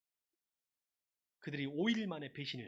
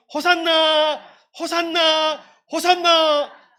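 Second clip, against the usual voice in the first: very loud, high, long-held Hosanna; ringing shout; 13 semitones higher, 21 dB louder, much slower than usual. Shown as a demonstration. Preacher showing congregation how the crowd cheered, ringing shout. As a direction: make it ring out, three long cheers. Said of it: "이렇게 더 크게 쩡쩡 울릴 정도로".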